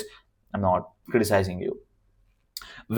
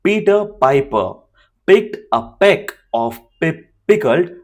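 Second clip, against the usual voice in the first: louder, higher tongue twister with a near stumble; raised tongue twister, almost tripping; higher, louder second try; 6 semitones higher, 4 dB louder than usual. Shown as a demonstration. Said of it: "I was about to stutter there".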